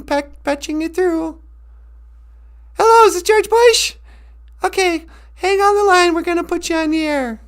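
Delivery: high-pitched phone operator, loud; a chirpy switchboard lady patching a call through. Acted. Switchboard operator to the radio host, high and chirpy.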